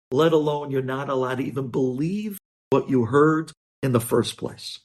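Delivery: ordinary talk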